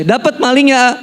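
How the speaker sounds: high-pitched mock mother's question; anxious mother. Mother asks her son about catching the thief, anxious. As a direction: imitate a mother asking eagerly, voice way up. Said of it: "ditanya sama ibu saya".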